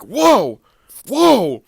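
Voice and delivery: mock alarmed whoa; startled yell. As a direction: shout it like you just got bitten. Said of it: "we see him go like".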